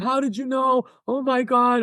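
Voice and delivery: high-pitched imitation of frantic yelling; mock screaming. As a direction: mimic a stunned scream without the volume. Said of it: "screaming and yelling".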